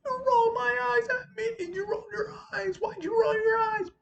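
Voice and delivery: very high, strained squabbling voice; mock angry girl. Girl confronting another over eye rolling, high mock shouting. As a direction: imitate a furious student in a squeal. Girls quarrelling, retold.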